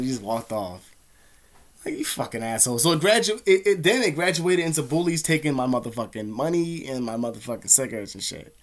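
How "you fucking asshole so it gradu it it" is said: ordinary delivery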